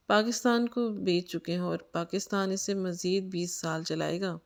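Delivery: usual speaking voice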